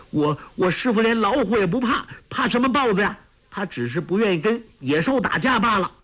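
put-on high, fast, stammering angry boy. A narrator's reading. Tolui defends his teacher, stammering and angry. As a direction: trip over the first words in anger. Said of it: "结结巴巴地说".

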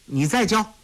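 put-on high, bright little boy's demand; a kid hungry for the next trick; eager child. A narrator's reading. Eager boy asks for more.

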